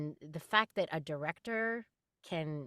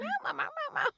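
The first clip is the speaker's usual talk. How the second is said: very high little meows; talking cat; much faster than usual. Retold or imitated. Nine-year-old cat answers his owner, high imitated meowing. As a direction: imitate a cat trying to talk. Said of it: "and he's like".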